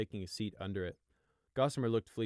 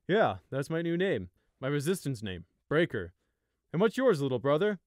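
tired smile; 6 semitones higher, 8 dB louder than usual. Acted. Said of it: "he asked with a tired smile".

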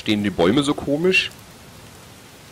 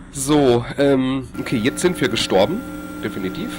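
ordinary talk

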